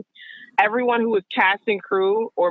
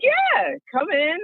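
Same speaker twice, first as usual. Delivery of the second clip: high-pitched, casual yes; upbeat retold welcome; 12 semitones higher, much slower than usual. Costume designer inviting the new hire in, high and upbeat. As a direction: voice the hirer lightly, pitched well up.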